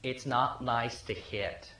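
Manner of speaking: put-on soft and slow, a little boy gently scolding; gentle child scolding. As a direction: say it quietly and slowly, like a small child correcting adults. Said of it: "and he says".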